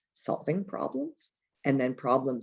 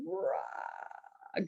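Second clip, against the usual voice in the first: Hulk roar; 11 dB quieter than usual. Pretend.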